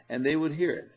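ordinary talk, nothing marked

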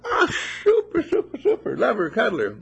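high, loud gushing approval; delighted cheer. Real, not acted. Praising a child for loving her doll, delighted high cheer. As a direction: squeal it with delight.

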